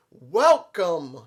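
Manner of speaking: loud, drawn-out welcome; booming ring welcome; Samoa Joe greeting. Retold or imitated. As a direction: boom the welcome like a ring entrance. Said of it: "he simply says".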